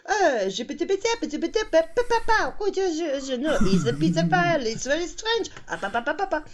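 very high, squeaky gabbled mock French; double-speed French film. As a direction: babble like a film played too fast. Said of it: "double speed".

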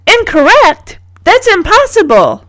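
high, indignant disbelief; challenging. A narrator's reading. Margot challenges the teacher, indignant. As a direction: dispute it as a know-it-all pupil would. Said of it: "Margot challenges".

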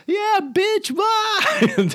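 squeaky high mock screech; hammed-up high-pitched taunt; Dallas impression. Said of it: "Does her little impression of Dallas".

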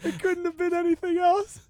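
high, laughing delivery; being tickled. Real, not acted. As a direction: talk while cracking up. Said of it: "that tickled me so much".

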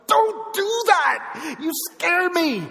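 loud, drawn-out high yell of frightened anger; angry outburst. Retold or imitated. Driver snapping at Amy after a scare, angry shout. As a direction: burst out in a startled, stretched-out shout. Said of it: "I was mad".

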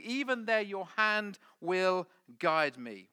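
high, loud, building proclamation of scripture; impassioned psalm declamation. Acted. Preacher quoting David's psalm to the congregation, impassioned. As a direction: proclaim it at full force, ringing out over the room.

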